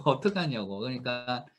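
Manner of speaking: ordinary delivery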